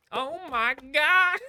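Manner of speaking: high, stretched-out oh my God; shocked gasp. Retold or imitated. Reacting to the classy lady's swearing, a stunned drawn-out oh my God. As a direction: gasp it slowly like you can't believe it. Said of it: "and I'm like".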